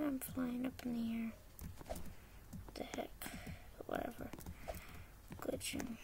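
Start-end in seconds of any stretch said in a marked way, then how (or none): none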